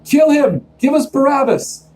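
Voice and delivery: high, wild mob yell; wild crowd shout. Acted. Crowd roaring at Pilate for Barabbas, wild high yell. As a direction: scream it like a frenzied crowd. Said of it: "the crowd went wild".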